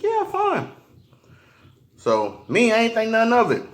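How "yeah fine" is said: mother's casual okay. Retold or imitated. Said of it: "She's like".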